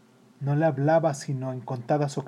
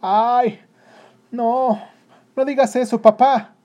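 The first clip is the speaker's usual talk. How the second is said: put-on loud, high anguished child's plea; desperate, high-pitched begging; crying out tearfully; 10 semitones higher, 10 dB louder, slower than usual. A narrator's reading. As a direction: cry out as if bursting into tears. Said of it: "gritó el niño rompiendo a llorar".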